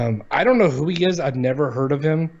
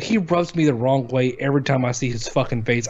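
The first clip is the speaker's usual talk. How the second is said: usual speaking voice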